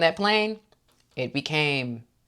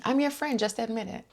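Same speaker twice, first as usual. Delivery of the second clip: a hint of high, sweet manipulative friend; mock Heather; 8 semitones higher, 6 dB quieter than usual. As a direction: coax her softly, faking kindness. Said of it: "such manipulation".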